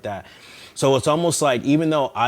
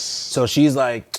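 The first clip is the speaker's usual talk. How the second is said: ordinary talk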